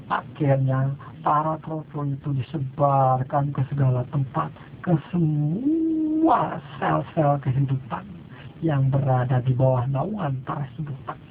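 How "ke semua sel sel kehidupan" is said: ordinary delivery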